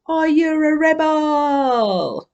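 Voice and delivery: rally the room, stretch every word; rousing call. Real, not acted. Host calling out to the whole audience, a long rousing shout.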